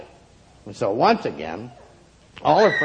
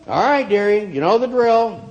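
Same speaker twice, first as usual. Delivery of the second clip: put-on high, loud old shopkeeper voice; an old shopkeeper gently warning her; 10 semitones higher, 5 dB louder than usual. A narrator's reading. Shopkeeper calls her dearie.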